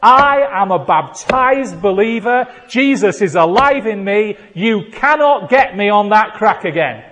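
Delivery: loud, high defiant proclamation; ringing preacher's rebuke. Shown as a demonstration. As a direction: declare it forcefully at the devil. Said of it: "say in a loud voice".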